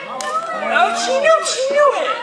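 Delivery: squeaky high mock voice; high teasing voice. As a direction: tease her in a high falsetto. Host teasing a contestant.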